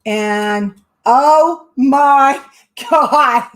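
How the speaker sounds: slow oh my God through real laughter; can't believe the name drawn; laughing disbelief. Real, not acted. Host seeing the winner's name, laughing in shock. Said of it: "I cannot stop laughing".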